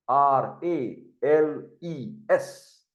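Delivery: R A L E S spelled letter by letter, very slowly; spelling out letters. Shown as a demonstration. Teacher dictating the word to students, slow spelling. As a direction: spell R A L E S out, one letter at a time. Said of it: "write down the word".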